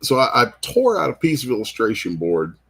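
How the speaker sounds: ordinary talk, nothing marked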